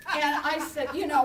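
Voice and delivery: ordinary delivery, nothing marked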